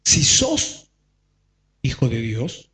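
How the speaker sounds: low, soft sly devil voice; a low, sneaky devil tempting; the liar devil. Acted. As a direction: whisper the devil's doubt, low and quiet. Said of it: "El mentiroso viene y te dice".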